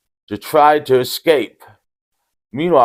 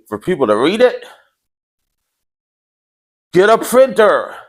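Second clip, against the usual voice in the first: hammed-up ad read, higher and brighter; mock sponsor ad; 6 semitones higher than usual. Vlogger pitching printers to his viewers, hammed-up ad voice. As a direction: sell it like a cheesy infomercial.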